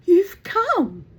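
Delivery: high, loud, swooping exclamation; Margot, dramatic. A narrator's reading. Daughter greeting Mother on arrival, dramatic high exclamation. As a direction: gush it with stagey drama. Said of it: "said Margot dramatically".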